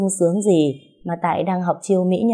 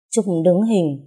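usual speaking voice

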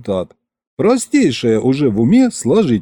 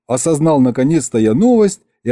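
usual speaking voice

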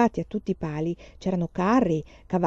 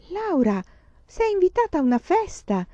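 much higher, thrilled little sister; excited exclamation; 11 semitones higher than usual. A narrator's reading. Carrie telling Laura about her party invitation, thrilled and high. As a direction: burst out with delight. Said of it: "esclamò Carrie".

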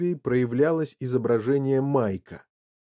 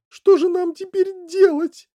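high, loud despairing mother; sobbing wail; 8 dB louder than usual. A narrator's reading. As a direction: wail it helplessly. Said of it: "зарыдала миссис Ти Ви".

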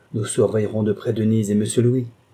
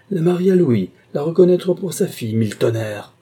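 loud, higher, furious cry of disbelief; angry outcry; 7 semitones higher, 5 dB louder than usual. Acted. An outraged schemer bursts out at his accomplice, loud furious cry. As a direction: explode with rage, cursing the news. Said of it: "s'écria-t-il".

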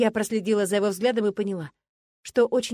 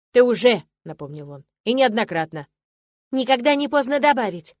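loud retort; 3 semitones higher, 7 dB louder than usual. A narrator's reading. Bickering exchange of retorts.

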